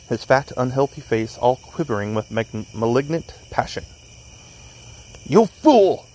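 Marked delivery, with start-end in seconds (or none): 5.3-6.1 high, loud, angry burst; furious outburst. A narrator's reading. Bellingham following Lee out in a passion, furious outburst. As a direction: spit it out in malignant passion. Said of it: "he hissed".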